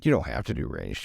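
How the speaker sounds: normal talking voice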